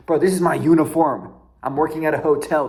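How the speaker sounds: retorting loudly to the rude hotel guest; exasperated retort. Retold or imitated. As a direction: snap back in disbelief at being called poor. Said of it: "I'm getting mad".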